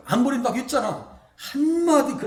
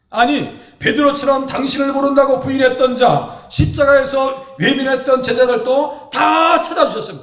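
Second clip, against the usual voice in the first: impassioned preaching; 4 semitones higher, 7 dB louder than usual. Real, not acted. Preacher stressing grace.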